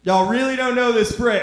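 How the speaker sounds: high, loud, laughing disbelief; incredulous laughing shout. Real, not acted. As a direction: practically shout it through laughter. Incredulous at silent audience.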